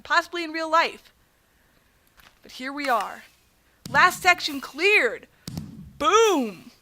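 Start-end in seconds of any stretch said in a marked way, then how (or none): 3.9-6.7 slow, drawn-out, louder victory call; triumphant announcement. Real, not acted. Gamer clearing the last section, triumphant. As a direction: proclaim it high, then punch the last word.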